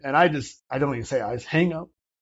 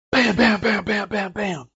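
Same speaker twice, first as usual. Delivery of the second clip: imitated door pounding; 7 semitones higher than usual. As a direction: punch out each bam like knocking.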